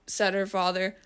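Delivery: usual speaking voice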